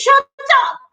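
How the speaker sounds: high sharp shout; angry yell. Retold or imitated. Parent yelling at the child to shut up, high and sharp. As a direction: snap it at the child.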